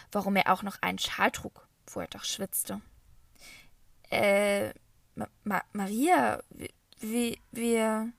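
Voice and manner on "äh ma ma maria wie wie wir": halting, broken-off stammer. A narrator's reading. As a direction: trip over every word in confusion. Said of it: "stammelte Mama". (stammelnd, ins Stocken geraten)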